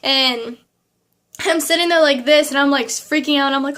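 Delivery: ordinary talk, nothing marked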